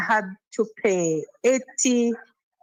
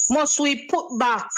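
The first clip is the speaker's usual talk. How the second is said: normal talking voice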